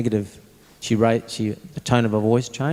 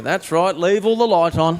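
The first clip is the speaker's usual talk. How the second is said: mock-agreeing sarcasm, a touch higher; sarcastic dad agreeing with the mistake; 6 semitones higher, 3 dB louder than usual.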